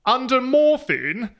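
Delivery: drawn-out mock posh English reading; posh English accent. Shown as a demonstration. Co-host reading the assigned sentence, hammed-up posh English. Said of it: "this is the really posh English accent".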